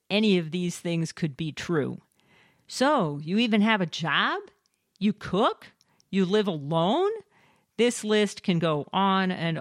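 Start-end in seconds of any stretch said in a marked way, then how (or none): 2.7-7.1 mock astonishment. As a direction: imitate incredulous questions, pitched up. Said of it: "with built-in exclamation points".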